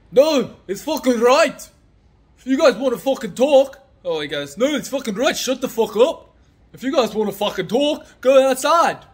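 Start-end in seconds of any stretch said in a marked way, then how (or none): 0.1-3.8 put-on high, loud angry Irish accent; worked-up Irish bloke, pitched way up. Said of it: "That was my Irish accent". 4.6-9.0 angry Irish shouting, pitched high; high-pitched Irish rant, building louder; Irish accent. Retold or imitated. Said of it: "That was my Irish accent".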